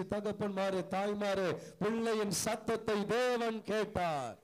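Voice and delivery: loud preaching climbing to a full-throated shout; full-force preaching. Real, not acted. Preacher crying out to brothers, sisters, young and old, full-force preaching. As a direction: drive the refrain home at the top of your voice. Preacher drives the verse home.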